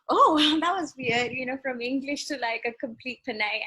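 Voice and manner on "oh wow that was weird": higher, louder amused surprise; retold amazement. Audition panel reacting to her switch in accent, amused surprise. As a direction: react with laughing, mock astonishment.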